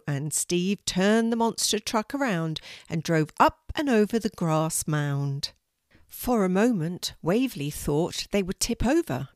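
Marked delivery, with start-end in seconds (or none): none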